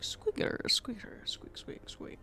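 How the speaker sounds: whispered squirrel-speak squeaking; Kronk. Acted. Streamer imitating a cartoon character talking squirrel, whispered squeaks. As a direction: squeak it out in a voiceless whisper, dying away. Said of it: "like Kronk from, like, the original New Groove".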